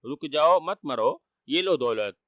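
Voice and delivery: ordinary delivery, nothing marked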